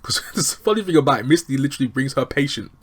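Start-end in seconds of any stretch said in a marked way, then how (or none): none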